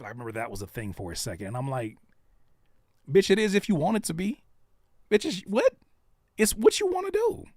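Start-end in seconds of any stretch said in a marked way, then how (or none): 3.1-7.4 incredulous retort. Retold or imitated. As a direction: fire it back like it's obvious. Retorting to meme question.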